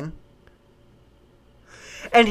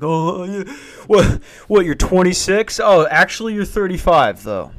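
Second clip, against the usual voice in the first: mock teasing; 5 semitones higher, 3 dB louder than usual. Mocking friends who have kids.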